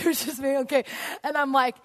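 usual speaking voice

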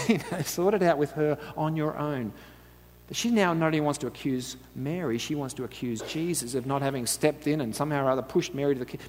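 ordinary delivery

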